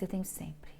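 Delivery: breathy whispered confession; whispered answer. Retold or imitated. Anxious girl telling the doctor she always has it, whispered. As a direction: whisper it, resigned.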